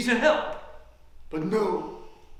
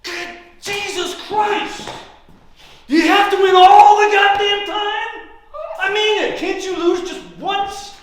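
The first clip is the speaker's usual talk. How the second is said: furious shout; 12 semitones higher, 12 dB louder than usual. Acted. Losing player yells at opponent who got gin, furious. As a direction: explode at the winner. Loser explodes at another gin.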